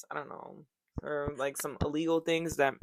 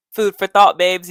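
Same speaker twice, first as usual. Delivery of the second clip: loud; 17 dB louder than usual. Real, not acted. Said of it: "punchy sign-off to viewers".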